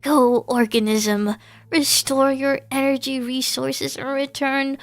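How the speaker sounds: high, flat machine boss praising a worker; robotic manager. Acted. Robot manager praises the cashier, high mechanical voice. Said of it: "I am feeling an emotion your kind describes as".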